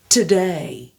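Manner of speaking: "Today" with the diphthong; model today. Shown as a demonstration. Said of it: "va apparaître dans day".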